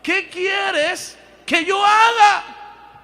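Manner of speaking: a high, booming call-and-response shout. Shown as a demonstration. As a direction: cry it out slowly for the whole church to hear. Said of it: "Otra vez, más fuerte".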